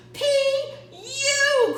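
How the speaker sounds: loud, high cry; the crowd crying out at the stink, loud and high. Acted. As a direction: yell it out in disgust. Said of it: "cried the crowd".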